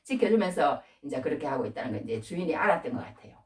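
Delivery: ordinary talk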